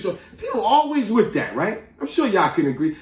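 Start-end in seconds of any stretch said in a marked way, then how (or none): none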